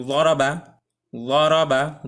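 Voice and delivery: ওয়ারাবা spoken loud with a heavy Arabic daad; Arabic letter sound. Shown as a demonstration. Teacher sounds out ওয়ারাবা for learners. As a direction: demonstrate ওয়ারাবা with a full, emphatic daad. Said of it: "সর্বপ্রথম initial দিয়ে উচ্চারণ করব".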